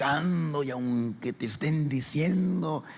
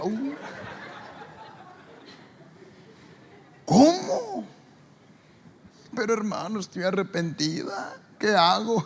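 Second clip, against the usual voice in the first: higher, louder pleading woman; distressed sister; 9 semitones higher, 5 dB louder than usual. Retold or imitated. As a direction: tell it anxiously. Sister telling pastor.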